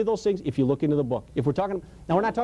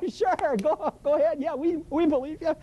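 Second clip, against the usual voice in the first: higher-pitched, jeering "sure, go ahead"; mocking crowd egging him on; 8 semitones higher than usual. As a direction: play the smirking onlookers cheering him toward disaster. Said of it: "And they're all going".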